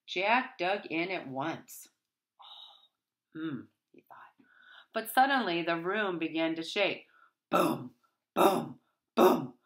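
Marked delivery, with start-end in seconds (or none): 2.4-4.4 breathy whispered thought; whispering. Acted. 7.5-9.6 loud stomping boom; booming footsteps. Acted. Giant footsteps sound.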